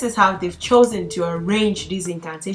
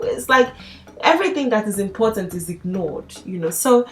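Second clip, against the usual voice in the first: normal talking voice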